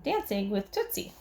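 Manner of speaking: ordinary delivery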